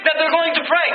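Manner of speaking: lightly acted, high-pitched approval; approving onlooker. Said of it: "we might look at it and say".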